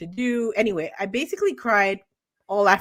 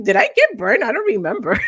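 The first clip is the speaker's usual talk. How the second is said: alarmed question; 3 semitones higher, 7 dB louder, faster than usual. Retold or imitated. Retelling own question to grandma.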